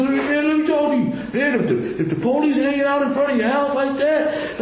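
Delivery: high mock voice with laughter breaking through; imitating his buddy, laughing. Telling interviewers the friend's warning about police, high and laughing. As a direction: parrot the friend's warning while chuckling. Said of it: "He said".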